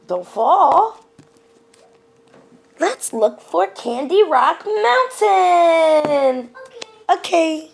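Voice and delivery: high, slow, playful cartoon voice; cartoon explorer voice. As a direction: talk like a kids' cartoon hero to the little one. Playing Dora with her child.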